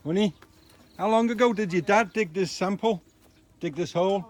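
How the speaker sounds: loud, high-pitched call to partner. Real, not acted. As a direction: project the question to someone at a distance. Calls to partner away from camera.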